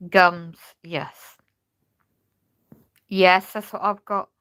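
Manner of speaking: usual speaking voice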